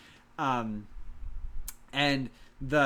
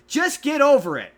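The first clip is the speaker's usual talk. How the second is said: much higher and louder mock-cheerful order; mocking Barney; 11 semitones higher, 11 dB louder than usual. Mocking Barney telling sad kids to move on, high and loud. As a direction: sneer the song's advice. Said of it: "just tells them to go".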